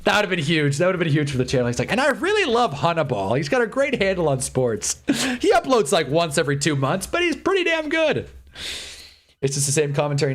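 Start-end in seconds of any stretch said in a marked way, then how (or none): 1.9-8.3 high-pitched pretend endorsement; imagined glowing praise. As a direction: play someone gushing about a favorite creator. Imagines a creator praising him.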